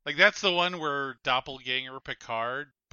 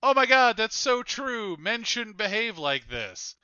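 mock amused reaction; 7 semitones higher, 4 dB louder than usual. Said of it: "laughing at it and going".

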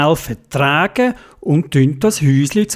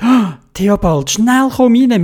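urgent call; 7 semitones higher, 4 dB louder than usual. Acted. Nicole urgently calls Theobald in.

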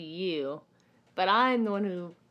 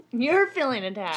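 laughing; 7 semitones higher, 6 dB louder than usual. Real, not acted. Laughing mocking echo.

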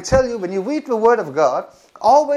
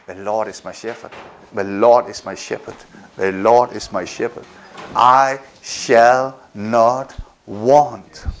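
much lower, hushed, slow prayerful murmur; quiet calm recitation; 10 semitones lower than usual. Shown as a demonstration. Preacher showing how to quiet a racing head, low hushed recitation. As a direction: let it settle deep and low, like a whispered prayer. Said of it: "So say".

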